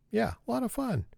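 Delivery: high, soft mock-enthusiasm; sarcastic. Real, not acted. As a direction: feign delight in a small high voice. Sarcastic aside on cat game.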